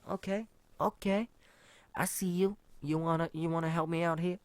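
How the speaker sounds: high-pitched, loud put-on approval; exaggerated high voice of someone catching on; mock impressed. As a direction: pitch it way up, playfully knowing.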